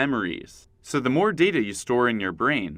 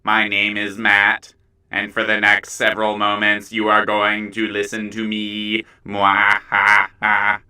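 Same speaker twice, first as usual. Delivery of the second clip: lower, louder, slow menacing villain voice; slow theatrical villain menace; 5 semitones lower, 5 dB louder, slower than usual. Acted. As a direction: loom over them like a cartoon villain. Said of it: "This is my normal voice".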